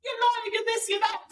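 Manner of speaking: very high parroted taunt; mock scoffing. Mimicking the scoffing driver.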